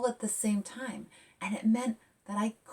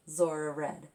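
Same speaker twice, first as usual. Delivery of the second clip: lively picture-book read; reading to children; 3 dB louder than usual. A narrator's reading. Author reading her picture book aloud to kids, lively. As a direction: read it bright and expressive.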